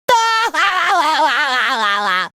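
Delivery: long, rippling cartoon laugh. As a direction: let out a long warbling laugh. Cartoon character's wordless sound.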